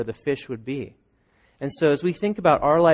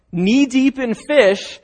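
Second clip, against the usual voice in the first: ordinary delivery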